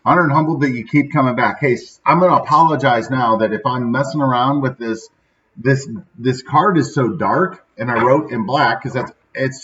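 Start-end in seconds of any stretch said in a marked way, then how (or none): none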